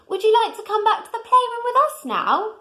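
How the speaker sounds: high, gentle invitation; kindly. A narrator's reading. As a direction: ask kindly like a small bear. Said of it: "said Little Bear kindly".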